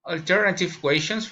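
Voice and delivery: ordinary talk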